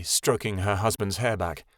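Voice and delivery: plain reading voice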